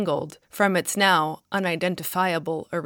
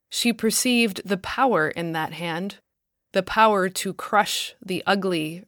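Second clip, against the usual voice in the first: usual speaking voice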